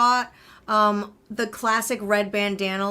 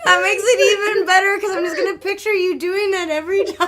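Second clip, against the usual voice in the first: laughing; 9 semitones higher, 7 dB louder, faster than usual. Real, not acted. Still laughing, delighted.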